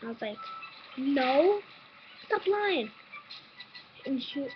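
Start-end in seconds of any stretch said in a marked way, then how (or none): 1.0-2.9 retold angry denial.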